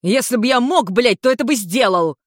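heated angry yell. A narrator's reading. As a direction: snap back at full irritation. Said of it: "заорал Улмар".

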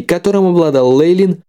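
usual speaking voice

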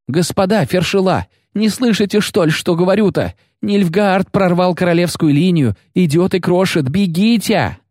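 a terrified soldier pleading high and fast; high trembling voice. A narrator's reading. Soldier warning the medics, high panicked trembling voice. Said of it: "высоким дрожащим голосом".

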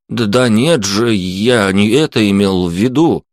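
ordinary delivery, nothing marked

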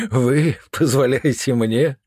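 laughing in scorn; mocking laughter. A narrator's reading. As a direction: laugh the words out in contempt. Envoy laughs at the count.

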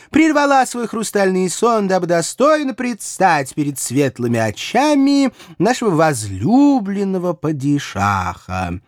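high, grandiose herald voice; pompous eunuch announcement. A narrator's reading. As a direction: proclaim it like a palace herald. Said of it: "высокопарно возвестил".

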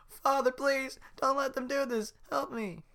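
very high loud begging; desperate pleading. Acted. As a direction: beg father for help. Pinocchio begs Geppetto.

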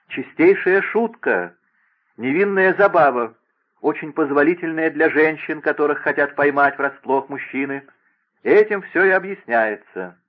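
приподнятый, звонко-напористый голос, выше и громче; убеждай с увлечением, выделяя каждую фразу; горячее убеждение. Acted. Принцесса горячо подсказывает оправдание. (горячо и настойчиво)

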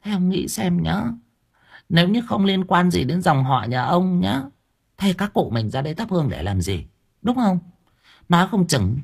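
put-on high, wheedling old woman; wife coaxing husband about the tomb, high wheedling. A narrator's reading. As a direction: sweet-talk him into it. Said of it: "đoạn tỉ tê".

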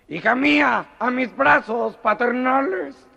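high, loud, slow grand greeting; father greets his daughter at the airport, hammed up; pompous father. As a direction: welcome her like a melodramatic father.

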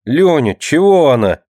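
put-on high, plaintive child's complaint; complaining. A narrator's reading. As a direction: whine to him about her. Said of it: "пожаловалась Мышка". (жалобно, высоким голоском)

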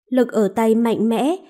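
ordinary delivery

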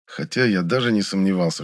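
plain reading voice

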